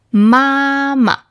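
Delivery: mama high and long, then short and light; "mama" with a sustained high start and light ending; first then neutral tone. Shown as a demonstration. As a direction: hold the first "ma" high, tap the second. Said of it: "neutral tone usually follows another character".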